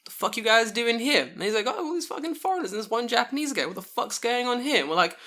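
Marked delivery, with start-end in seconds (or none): 0.1-1.2 high, raised-voice mock outrage; imitated indignant demand, pitched up; retold policeman's challenge. Acting the policeman confronting drinkers. 1.7-4.8 high-pitched mock disbelief; imitated exasperated squawk; baffled policeman. Acting the policeman's baffled reaction.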